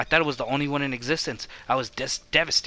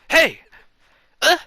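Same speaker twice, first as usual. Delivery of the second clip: startled call; 12 semitones higher, 9 dB louder than usual. Acted. Startled character calls out.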